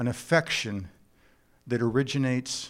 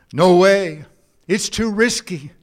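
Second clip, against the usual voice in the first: firm refusal; 4 semitones higher, 7 dB louder than usual. Acted. Girl refusing the kiss.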